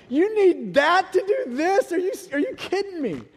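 high, incredulous outburst at the doctor; aghast, reliving his own reaction; shocked disbelief. Retold or imitated. Dad staring at a huge needle, incredulous.

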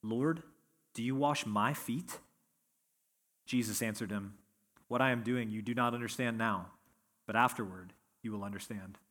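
soft, steady reading of the gospel text; scripture reading. Acted. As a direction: read the passage plainly and reverently. Scripture reading before the sermon.

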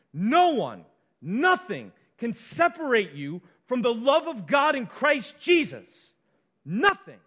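forceful preaching with punched key words; emphatic. Shown as a demonstration. Preacher telling the congregation nothing can separate them, emphatic. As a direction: drive each denial home with conviction. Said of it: "It's emphatic".

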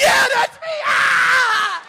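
loud, high laughing cry; overjoyed laughing yell; laughing shout. Real, not acted.

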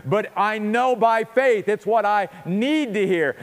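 usual speaking voice

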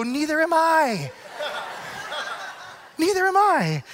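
risa contenida, en tono de broma; laughing, amused. Retold or imitated. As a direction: laughs it out, high and slow. Joking reply.

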